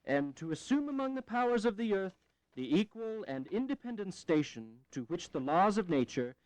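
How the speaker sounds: measured formal reading of a document; reading the draft aloud. Acted. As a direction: read it out like a proclamation. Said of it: "Oh, very well".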